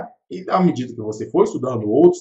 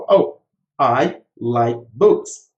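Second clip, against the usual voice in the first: slow model sentence; 5 semitones lower, 4 dB louder, much slower than usual. Shown as a demonstration. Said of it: "Então".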